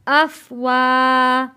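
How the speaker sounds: very slow, lips rounded on "वा"; slow joined recitation. Shown as a demonstration. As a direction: stretch "अफ वा" out. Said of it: "होंठों को गोल करके". ("अफ वा" धीरे, गोल होंठ)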